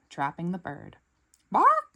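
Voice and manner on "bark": squawking chicken. Acted. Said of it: "it objects".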